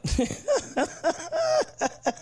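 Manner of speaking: high, wheezy run of giggles; laugh. Real, not acted. Streamer amused at own whispered bit, laughing. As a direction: crack up in a long cackling laugh.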